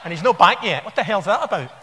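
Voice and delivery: quick, laughing mock complaint from the wife; mock nagging wife. Wife complaining he never came back from the shop, mock nag. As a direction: rattle it off like a fed-up wife, laughing. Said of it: "his wife's in a flat somewhere saying".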